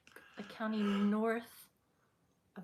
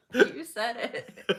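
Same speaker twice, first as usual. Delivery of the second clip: slow, loud laughing words; words squeezed out through laughter; 14 dB louder, much slower than usual. Real, not acted. Laughing at a joke.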